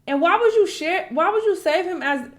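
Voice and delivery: high, loud disbelieving yell; shouting in outrage at the screen; outraged yelling. Real, not acted. Reacting to the contact name, loud outraged yell.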